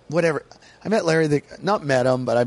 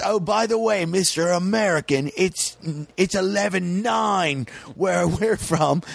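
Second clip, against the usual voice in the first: higher, slowing mock British put-down; British comic jab; 6 semitones higher, slower than usual. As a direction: tease the American in a British voice. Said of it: "And he goes".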